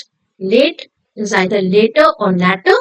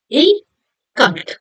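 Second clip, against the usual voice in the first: slow 'difficult', broken into dif-fi-cult; syllable by syllable; 4 semitones higher, much slower than usual. Shown as a demonstration. Teacher showing children the three syllables, syllable by syllable. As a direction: say 'difficult' in three separate beats. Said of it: "So three syllables".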